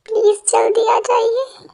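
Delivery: a high, pleading whisper, slow. Acted. Wife alone at night begs her husband to come home, whispering. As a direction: beg him to come home, barely voiced.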